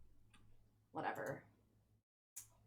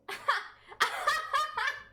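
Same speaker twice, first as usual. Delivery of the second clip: high shrieking laugh; 4 dB louder than usual. Real, not acted. Streamer laughing at her own bad joke, shrieking.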